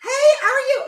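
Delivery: high, loud, gushing hello; excited greeting. Real, not acted. Host greeting Facebook viewers, high and excited. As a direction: greet them in a high squeal.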